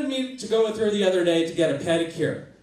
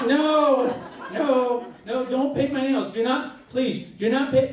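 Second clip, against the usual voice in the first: mock pleading; 7 semitones higher, slower than usual. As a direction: beg her to stop, hammed up. Acted protest against painted nails.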